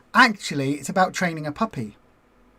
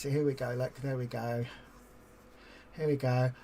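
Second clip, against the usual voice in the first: muttering to self; 7 semitones lower, 11 dB quieter than usual. Real, not acted. Quietly drawing while talking.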